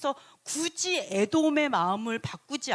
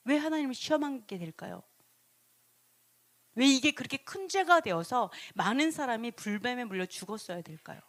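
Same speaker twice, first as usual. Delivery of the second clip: ordinary delivery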